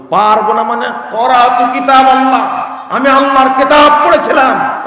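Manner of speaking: loud, high proud answer; loud, high triumphant reply; confident grave dweller. Retold or imitated. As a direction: voice the dead man answering boldly. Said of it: "তখন ওই কবরবাসী বলবে".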